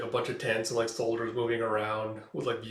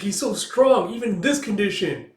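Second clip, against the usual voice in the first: higher, louder amazed cry; awed exclamation; 8 semitones higher, 6 dB louder than usual. Retold or imitated. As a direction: gasp out his strength in awe, louder. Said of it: "It's like".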